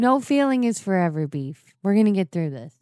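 reassuring. Acted. Comforting a sad friend.

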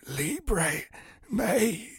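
high rasping plea from a creature; pleading rasping voice. Acted. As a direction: beg in a dry rasping creature voice. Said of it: "a note of pleading in the words".

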